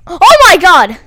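loud, sudden yelp of fright; startled cry. Real, not acted. Player reacting to a scare in the game, startled cry. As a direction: cry out as if jumped.

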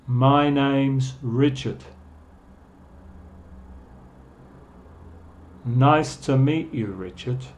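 slow, careful phrases with long gaps to repeat; unhurried teacher's pronunciation demonstration. Shown as a demonstration. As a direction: model each phrase slowly for learners. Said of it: "voy a decirlos más lento".

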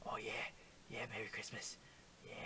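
very soft, low seductive murmur; mock-sultry whisper. Player flirting with the face being painted, mock-sultry whisper. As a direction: whisper it like pretend pillow talk.

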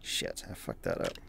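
low, soft muttered blooper. Real, not acted. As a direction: mutter it to yourself.

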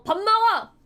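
high, loud call to eat; mom calling out. Retold or imitated. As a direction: imitate a mother shouting for the kid. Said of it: "엄마가". (크게 밥 먹으라고 부르는 엄마 흉내)

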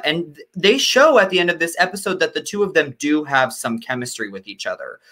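ordinary talk, nothing marked

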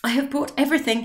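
lightly voiced excited cry; cried out eagerly. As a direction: burst in with the bag. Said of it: "he cried".

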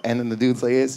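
usual speaking voice

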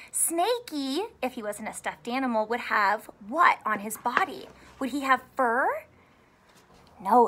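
ordinary talk

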